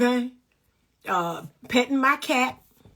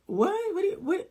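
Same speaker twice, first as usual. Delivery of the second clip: high, soft baffled what; 8 semitones higher, 3 dB quieter than usual. Pretend. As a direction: sound totally lost, as if hearing a foreign language. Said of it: "I'm like".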